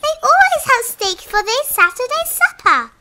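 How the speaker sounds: very high, loud creature call; a shrieking Grickle calling out to the Nupper. Acted. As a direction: shout it in a tiny high creature squeal. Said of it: "the Grickle called out to a Nupper".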